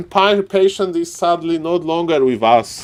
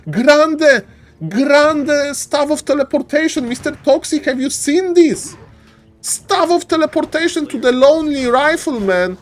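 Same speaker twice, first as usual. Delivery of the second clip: excited hype; 10 semitones higher than usual. Real, not acted. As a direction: call it like the crowd just rose. Caster hyping teleport staff.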